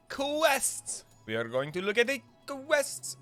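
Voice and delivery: high-pitched mock-grand hype on the quests; playful hype. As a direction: draw out "quests" high and silly. Hypes the quest reveal playfully.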